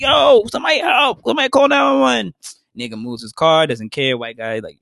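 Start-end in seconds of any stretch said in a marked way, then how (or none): 0.0-2.3 high, loud desperate call for help; shout for help. Retold or imitated. Injured runner shouting to a stranger's house, loud and high. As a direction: yell to the street for someone to help you.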